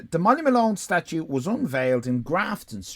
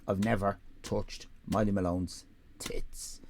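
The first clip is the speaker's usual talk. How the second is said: whispered, low and soft; conspiratorial whisper; 4 semitones lower, 7 dB quieter than usual. Real, not acted. Confessing to the viewers about the statue, whispered. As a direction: let the camera in on a secret. Confiding aside to camera.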